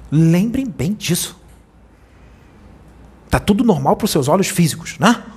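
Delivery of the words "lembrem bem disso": "Lembrem bem disso" drawn out slowly, each word weighed; slow it right down so it sinks in; grave slow reminder to the congregation; slow solemn repeat. Shown as a demonstration. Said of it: "Lembrem bem disso que eu tô falando agora".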